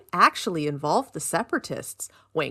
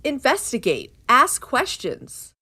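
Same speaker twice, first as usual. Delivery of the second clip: slow emphatic; 5 semitones higher, 4 dB louder, slower than usual. Real, not acted. Emphatic instruction.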